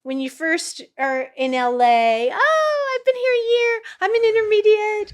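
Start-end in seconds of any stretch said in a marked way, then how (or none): 2.3-5.1 much higher, louder mock bragging; breathless self-important bragging; boastful newcomer. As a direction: parody a cocky LA newbie. Mocks eager newcomers in LA.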